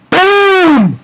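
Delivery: high, drawn-out falling "boom"; explosive boom sound effect; mimicked blast. Reviewer imitating [name]'s one hit, high booming burst.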